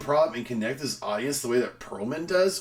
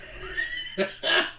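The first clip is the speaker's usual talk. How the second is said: sudden breathy laugh; burst of laughter. Real, not acted. Losing it mid-sentence while rereading the review, laughing. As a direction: break off into laughter.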